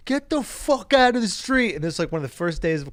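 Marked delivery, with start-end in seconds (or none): 0.1-1.8 high, drawn-out cabbie yell; yelling taxi driver. Retold or imitated. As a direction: imitate a cabbie yelling at a pedestrian, slowly. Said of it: "And a taxi cab goes".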